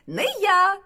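high, loud little mouse; piping mouse shout; shouted refusal. A narrator's reading. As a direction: yell it out in a high voice. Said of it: "гукає верть".

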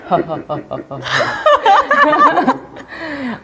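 spooky ho-ho-ho laugh through a voice changer; menacing Halloween cackle; scary laugh. Shown as a demonstration. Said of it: "you can sound really scary with a laugh".

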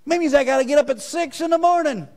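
high mock whining; whining complaint. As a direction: whine like a child told to get up. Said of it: "we whine about everything".

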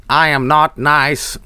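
each word spaced and slow; slow, deadpan recital; slow reading. Pretend. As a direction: read it out deliberately. Reading a chat name.

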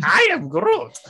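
high, squeaky, laughing Groot. Retold or imitated.

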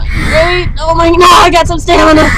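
ordinary delivery

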